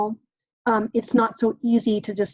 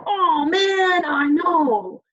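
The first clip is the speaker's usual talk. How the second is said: high, loud wailing "oh man"; match the kid's big feeling; showing how to match a child's intensity; 8 semitones higher, 4 dB louder, much slower than usual. Shown as a demonstration. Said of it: "That's the intensity".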